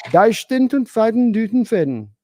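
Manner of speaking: loud, high mock German gibberish; fake German. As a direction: ham up fake German phrases. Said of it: "I'll learn a few German phrases".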